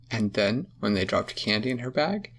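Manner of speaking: ordinary delivery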